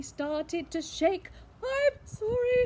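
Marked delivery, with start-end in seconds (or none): 1.6-2.6 high, loud, frightened splutter. Acted. Dragon apologising to the beast, high and frightened. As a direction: blurt it in fright. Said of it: "he spluttered".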